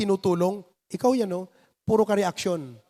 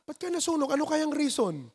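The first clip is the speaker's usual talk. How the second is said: prying; 4 semitones higher, 6 dB quieter than usual. Retold or imitated. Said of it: "yung iba naman".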